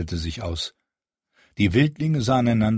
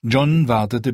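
ordinary talk

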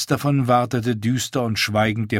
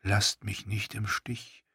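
soft, slow inner voice; John's soft, slow inner thought; thought aloud; 9 dB quieter than usual. A narrator's reading. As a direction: hush it like a private thought. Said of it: "dachte er".

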